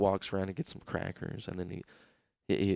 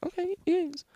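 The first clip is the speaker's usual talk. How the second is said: much higher, falsetto shopkeeper voice; high vendor voice; 4 dB louder than usual. Retold or imitated. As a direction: squeak it out like a shopkeeper.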